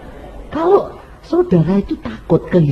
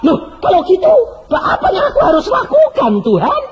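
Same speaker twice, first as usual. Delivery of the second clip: urgent cry; 4 dB louder than usual. Retold or imitated. Preacher's urgent question to God.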